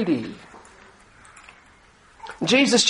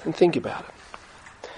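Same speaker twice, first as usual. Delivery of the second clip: hushed; 5 semitones lower, 8 dB quieter than usual. Real, not acted. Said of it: "Please listen".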